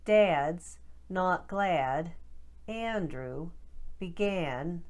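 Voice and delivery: Boston short a with eh off-glide on each word; Boston accent. Shown as a demonstration. Coach drills Boston short a words for practice. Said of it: "Let's try a few more for practice".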